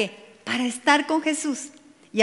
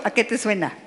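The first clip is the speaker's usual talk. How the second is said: normal talking voice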